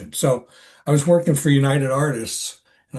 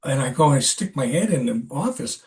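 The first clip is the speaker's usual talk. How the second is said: normal talking voice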